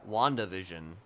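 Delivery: normal talking voice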